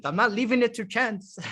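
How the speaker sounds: lightly mimicked French accent. French girl stopping him from leaving, French accent. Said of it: "In her little French accent".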